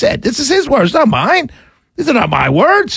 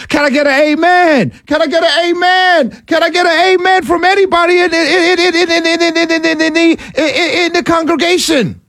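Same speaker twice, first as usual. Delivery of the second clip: playful church call-and-response, a touch higher; mock preacher; 7 semitones higher than usual. Host playing pastor, calling to his congregation, playful preaching. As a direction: preach it like a Sunday pastor working the room. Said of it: "Where's my organ at?".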